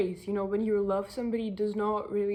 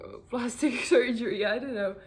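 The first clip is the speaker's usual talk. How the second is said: words broken by laughs; can't keep a straight face on the insult; laughing through it; 5 dB louder than usual. Real, not acted. Vlogger mocking self-talk, laughing mid-word.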